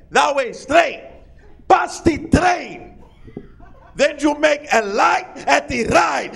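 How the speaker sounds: put-on eager stranger explaining directions in Spanish accent; hammed-up Spanish-accented helper; excited Spanish accent. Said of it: "He got excited".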